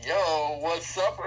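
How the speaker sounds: chuckling casual "Yo, what's up?"; a light laughing "Yo" greeting as a demo; laughing street greeting. Shown as a demonstration. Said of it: "I started talking like this".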